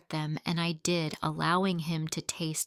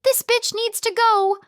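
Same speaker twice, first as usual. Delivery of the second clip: a shrill, much higher and louder furious yell; 8 dB louder than usual. Acted. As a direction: shriek it like she'll tear the room apart. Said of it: "Clara yelled furiously".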